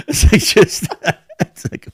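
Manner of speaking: high, breathy laughter through words; laughing. Real, not acted. Laughing at his own Batista impression.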